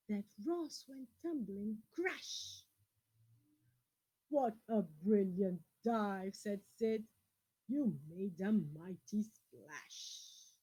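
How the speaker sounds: put-on lively picture-book reading to children; storyteller voice for kids; story reading. A narrator's reading. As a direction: read it out to the class with expression. Reads a picture book aloud.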